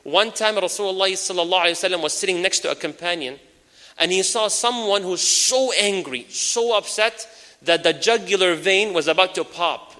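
usual speaking voice

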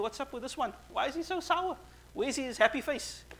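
high-pitched, bemused questioning; retold bafflement at someone's sourness; puzzled questioning. Acting the Prophet's puzzled question to Jibreel.

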